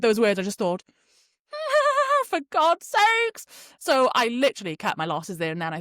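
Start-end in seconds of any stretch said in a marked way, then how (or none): 2.3-3.5 exasperated. Retold or imitated. Exasperated at the full train.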